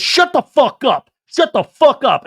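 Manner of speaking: loud, high, each word punched; acted-out angry outburst; furious retold shout. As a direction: yell it as an imitation of a screaming row. Acting Jesse's shouting in a quarrel.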